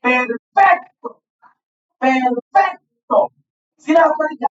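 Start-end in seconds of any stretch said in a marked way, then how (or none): 0.0-1.2 slow syllable demo. Shown as a demonstration. Teacher to class, showing the stress, slowly sounding out Perfecto. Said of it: "Escuchen cómo la pronuncio". 2.0-3.3 Perfecto drawn out, falling on the end; slow syllable demo. Shown as a demonstration. Teacher to class, repeating the word, slowly dragging Perfecto out. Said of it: "Escuchen cómo la pronuncio".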